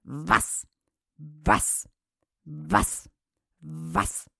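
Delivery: low, soft barked Was, fading out; dog barking words. Acted. Greyhound barking at the meat, low and soft. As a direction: bark it like a hound. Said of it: "schnupperte und bellte".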